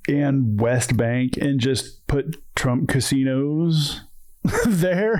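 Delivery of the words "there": laughing. Real, not acted. Laughing at the absurdity.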